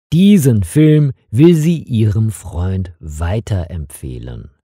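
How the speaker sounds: plain reading voice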